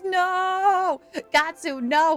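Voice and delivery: loud, high, drawn-out no; protesting cry. Real, not acted. Streamer objects to chat cooking the snake, protesting cry. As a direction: cry out against the idea.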